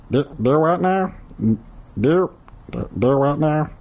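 high cartoon character voice; confused cartoon impression; Meatwad. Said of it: "that was Meatwad".

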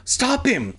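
high urgent cry; shouted. Acted. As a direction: call out in alarm, pitched high. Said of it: "I shouted".

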